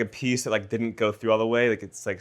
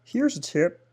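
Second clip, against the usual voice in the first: high, strained voice after a cut; pained; 9 semitones higher than usual. Real, not acted. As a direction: squeeze it out through pain. Pained tip after cutting finger.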